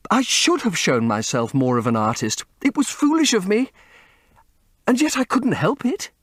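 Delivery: put-on high, desperate young woman's plea; pleading young woman. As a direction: beg him not to leave. Sibyl pleading continues.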